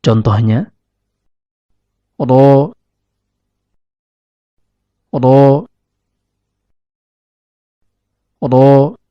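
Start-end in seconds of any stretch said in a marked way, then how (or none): 2.2-9.0 "ro" with tongue tip to upper gum, held; Arabic letter ra. Shown as a demonstration. Teacher demonstrates the letter ra for learners, "ro" drawn out from the tongue tip. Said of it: "Huruf ra keluar dari ujung lidah".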